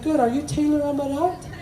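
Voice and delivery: put-on soft, high child voice; small, high-pitched kid; little boys. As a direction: imitate a small boy asking a question. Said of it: "They're like".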